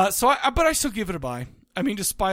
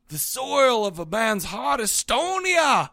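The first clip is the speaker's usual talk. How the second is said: slow Northeastern drawl quoting Judd; slow, higher Northeastern accent for Judd; 5 semitones higher, much slower than usual. Retold or imitated. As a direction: lay on a Maine accent, slowly. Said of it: "in my best Northeastern accent".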